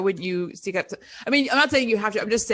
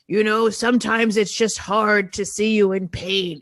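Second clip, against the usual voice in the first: imitating elderly dad. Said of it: "an eighty-five-year-old being like".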